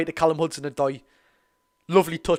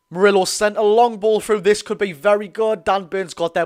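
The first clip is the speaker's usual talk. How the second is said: loud, higher call on the long ball; excited commentary; 4 semitones higher, 6 dB louder than usual. Acted. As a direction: sell the danger of the through ball. Live play-by-play from the stream.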